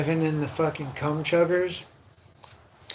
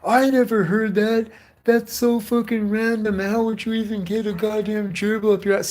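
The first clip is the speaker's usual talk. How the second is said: imitating the friend in a raised, higher voice; mock friend's disbelief; 6 semitones higher than usual. Retelling a friend who never heard the rumor, higher mock voice. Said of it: "They're like".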